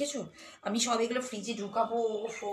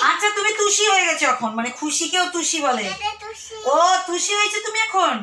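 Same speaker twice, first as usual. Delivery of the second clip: much higher, louder sing-song coo; 9 semitones higher, 8 dB louder than usual. Real, not acted. Mother teasing her toddler about her name, high baby talk.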